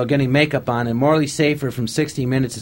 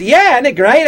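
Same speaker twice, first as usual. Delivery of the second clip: gleeful; 12 semitones higher, 9 dB louder than usual. Retold or imitated. Director confirms the booking.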